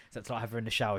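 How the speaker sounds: ordinary talk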